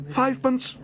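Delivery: very high, loud eager cry; excited cry. Acted. Bear excitedly bids on the marmalade stand. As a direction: bid eagerly, bursting out. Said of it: "cried Paddington excitedly".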